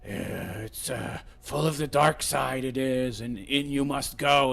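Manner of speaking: a hushed half-hearted Yoda; quiet Yoda impression, under the breath; imitating Yoda. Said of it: "and Yoda said".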